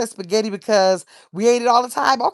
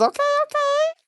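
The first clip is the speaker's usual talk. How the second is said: high squeaky okay. Real, not acted. Reacting to chat suggestion.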